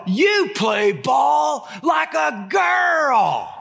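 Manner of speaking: high, slow sneering playground taunt; kid's taunt. Retold or imitated. Catcher taunting the smart aleck kids, high slow sneer. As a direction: drag it out like a bratty kid.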